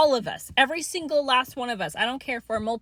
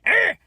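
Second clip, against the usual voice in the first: frustrated groan; 10 semitones higher, 7 dB louder than usual. Real, not acted.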